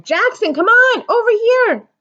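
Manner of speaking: high, loud urgent call; loud high insistent call; insistent shout. A narrator's reading. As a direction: plead across the crowd. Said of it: "Lady shouted again".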